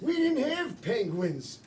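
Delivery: put-on high, slow, laughing old-man voice; creaky grandpa telling a tale. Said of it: "the players are gonna pretend that they're".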